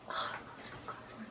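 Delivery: breathless whisper of a crush; whispered swoon. Acted. Fan swooning over Sam Claflin, whispered.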